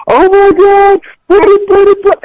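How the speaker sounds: high, loud hammed-up shriek of fear; mock panic. Mocking how scared Americans react, high hammed-up panic. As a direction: play a frightened person losing it.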